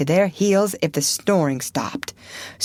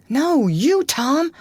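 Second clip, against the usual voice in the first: higher, pleading scared voice; frightened; 10 semitones higher, 4 dB louder, slower than usual. Acted. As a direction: beg him in panic. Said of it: "in an agony of fright".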